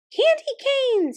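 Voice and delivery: very high, slow squeak; high squeaking mouse general; squeaky mouse voice. Acted. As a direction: squeal it like a tiny angry mouse. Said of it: "he repeated".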